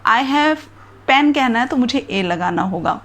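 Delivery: usual speaking voice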